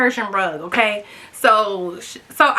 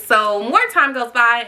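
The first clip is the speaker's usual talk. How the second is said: ordinary delivery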